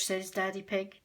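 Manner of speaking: plain reading voice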